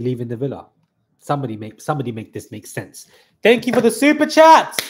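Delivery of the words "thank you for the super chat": much higher and louder thanks; much higher, louder thanks to a viewer for a super chat; excited thank you. Real, not acted. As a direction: burst out with delighted gratitude.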